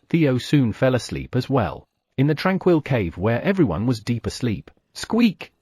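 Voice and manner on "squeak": high sharp rabbit squeak; piercing little squeak. Acted. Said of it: "The scream of the wife rabbit".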